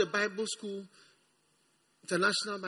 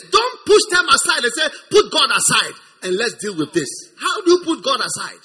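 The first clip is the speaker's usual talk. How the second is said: loud exhortation; 5 semitones higher, 12 dB louder than usual. Real, not acted. Preacher raises to exhortation.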